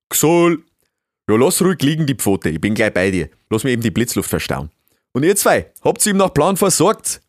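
loud beaver giving brisk orders in dialect; busy beaver boss. Acted. Beaver seeing to his injured guest and helpers, bossy and loud.

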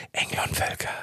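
hushed, whispered trailer imitation. Showing how his property trailer sounded, mock dramatic whisper. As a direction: whisper the brand like a film trailer.

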